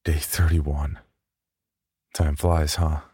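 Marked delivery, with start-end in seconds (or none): none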